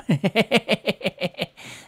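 high amused laugh; laughing at his own taunt; high burst of laughter. Real, not acted.

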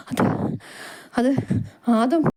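halting words between breaths; panting. Acted. Servant brings his boss alarming news, panting. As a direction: gasp it out in pieces. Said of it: "നീ എന്തിനാ ഇങ്ങനെ കിതയ്ക്കുന്നത്".

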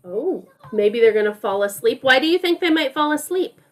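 normal talking voice

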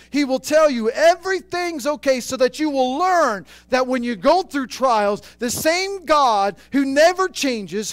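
climbing from firm to loud preaching; building sermon. Acted. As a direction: build the passion line by line. Preaching rising to full force.

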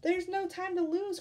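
high, loud call to action; urgent little chick, calling out; urgent cry. Acted. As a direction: cry out in a rush of alarm. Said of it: "cried Chicken Little".

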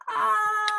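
high mock "aah" of fright; drawn-out pretend cry of fear; scared wail. Acting out the scared dragon.

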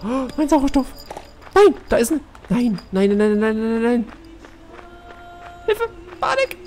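frantic high panicked cries. Real, not acted. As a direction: panic as your air runs out. Oxygen running out, panicking.

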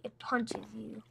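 hushed repeat; whispering. Real, not acted. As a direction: drop to a whisper. Whispered repeat of own words.

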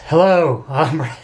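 mock deep voice, loud on the hello; put-on deep voice. Shown as a demonstration. Answering a viewer's request, mock deep voice. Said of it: "I'll say it in my deep voice".

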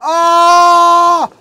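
triumphant cheer. Real, not acted. Celebrating a trick.

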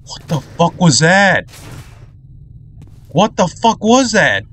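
high, drawn-out startled outburst; rattled, pitch jumping up and falling off at the end; startled alarm. Real, not acted. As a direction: cry out in alarm, stretching the words. Startled by something in the game.

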